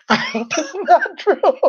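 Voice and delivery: high, squeezed words through laughter; real laughing. Real, not acted. Vlogger teasing a friend about a crush, laughing. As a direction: laugh all the way through the line. Cracks up after mock warning.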